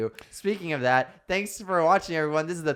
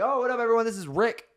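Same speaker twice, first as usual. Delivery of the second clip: mock cheery introduction; 7 semitones higher than usual. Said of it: "I'm like".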